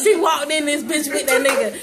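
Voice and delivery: ordinary talk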